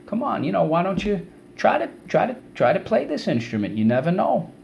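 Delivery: high-pitched, wheedling persuasion; coaxing. Acted. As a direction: talk them into it, lightly pushy. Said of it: "when someone tries to influence you".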